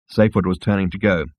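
ordinary delivery

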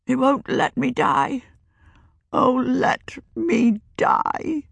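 put-on an old woman begging for death; the cursed witch pleading to be let die; despairing old witch. A narrator's reading.

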